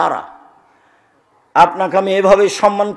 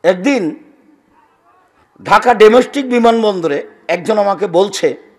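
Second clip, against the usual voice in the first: ordinary delivery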